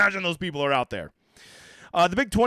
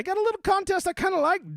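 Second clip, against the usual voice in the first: much higher, sing-song; coy high voice; 13 semitones higher than usual. Acted. Host coyly hinting at a rival site, pitched high. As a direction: tease it playfully.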